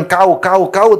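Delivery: loud, high, mock-harsh "kau, kau"; harsh scolding. Wife snapping at her husband, loud mock scolding. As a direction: jab the words at him like a sharp-tongued wife. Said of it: "Meskipun dia bilang".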